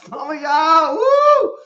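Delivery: high, loud whoop of joy; preacher whooping at the crowd; excited whoop. Real, not acted. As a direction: let it burst out.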